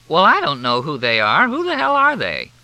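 put-on high, loud tipsy old woman; indignant old lady. A narrator's reading. Leona Hatch demanding to know who the strangers are.

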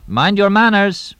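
loud, high, slow warning call. Acted. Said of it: "Tonino called in Portuguese".

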